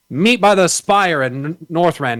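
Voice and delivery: drawn-out, emphatic mock quest order; mock quest-giver. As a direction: announce it like a game quest-giver. Mimicking a WoW quest instruction.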